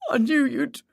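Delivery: high, breaking off in tears; tearful. A narrator's reading. Grieving boyfriend breaking down before the detectives, tearful. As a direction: choke on it and stop.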